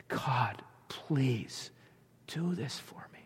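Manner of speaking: soft unvoiced whisper of a prayer; whispered plea. Preacher voicing David's plea to God, whispered.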